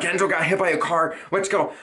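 high, frantic telling mom; urgent. Retold or imitated. Son telling mom the dog was hit, frantic. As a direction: blurt it out in a panic. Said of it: "I was like".

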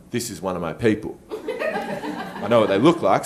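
quiet, low inner voice; inner thought. Retold or imitated. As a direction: think it softly to yourself. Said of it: "he thinks to himself".

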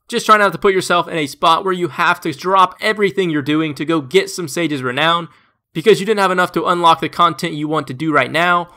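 usual speaking voice

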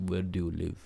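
low, very soft imitated menace; knife-wielder's threat. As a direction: ask it quietly, as a mock threat. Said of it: "that guy takes a knife out".

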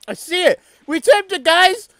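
high, loud triumphant cheer; excited cheering. Real, not acted. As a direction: cheer the win. Triumph at tipped iceberg.

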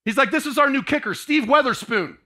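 rally the players in; loud coach announcing the new kicker; coach calling out. Retold or imitated. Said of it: "coach says".

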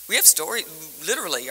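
ordinary delivery

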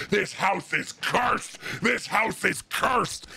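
rough, growled imitation of a ranting doomsayer; super low voice. Retelling the woman cursing the house, super low growled voice. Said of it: "screaming at our house, going".

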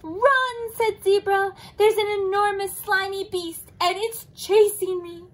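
high, loud panicked warning; alarmed. Acted. Zebra warning sleeping Leopard in a high panic. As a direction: shout it in a panic.

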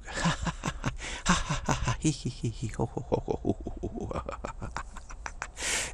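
long forced laugh through ha, hee and ho; rhythmic staccato belly laughs, changing vowels; laughing yoga. Shown as a demonstration. Demonstrating laughing yoga, deliberate forced laughter. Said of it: "We can do some laughing yoga".

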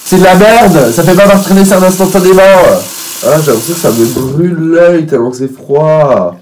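loud, annoyed griping; loud complaining. Real, not acted. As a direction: shout out the complaint. Cold water on his face. (plainte bruyante)

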